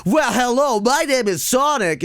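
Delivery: high, hammy cartoon charm; Sonic. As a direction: lay on the cheesy flirting. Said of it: "let me just put on the charm".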